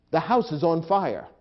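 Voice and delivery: urgent alarm about a burning house, high and loud. Shown as a demonstration. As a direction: sound the alarm. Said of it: "contains a great deal of emotion and urgency".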